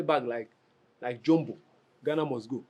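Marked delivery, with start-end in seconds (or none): none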